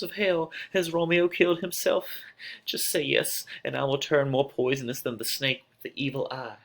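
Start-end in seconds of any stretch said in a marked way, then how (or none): none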